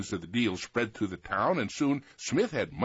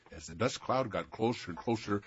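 ordinary delivery

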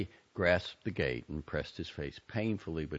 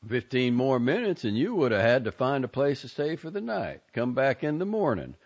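a louder folksy guard; lightly voiced drawling guard; 9 semitones higher, 8 dB louder than usual. A narrator's reading. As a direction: greet the boy at the gate.

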